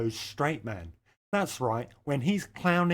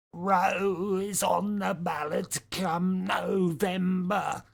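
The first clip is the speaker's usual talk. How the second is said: put-on very slow, higher old-man voice; Biden impression; 5 semitones higher, much slower than usual. Mocking Joe Biden's campaign line, slow old-man impression. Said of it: "That's exactly how he said it, because he's an extraordinarily old man".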